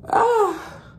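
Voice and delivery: sudden high yelp; sharp yelp. Real, not acted. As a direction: yelp in pain. Lens won't come out.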